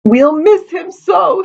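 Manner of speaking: slow, very high sobbing wail; crying. A narrator's reading. Daughter mourns the son she imagines, crying. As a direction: bawl it in drawn-out acted sobs. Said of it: "sounding like I'm crying".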